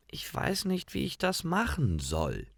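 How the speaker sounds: feigned helplessness. A narrator's reading. Gretel pretends not to know how, soft and timid. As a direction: play innocent and unsure.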